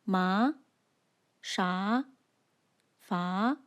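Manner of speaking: "Ma, sha, fa" each rising upwards; second tone. Shown as a demonstration. Teacher demonstrates the second tone, "Ma, sha, fa" rising. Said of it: "second tone rises upwards like this".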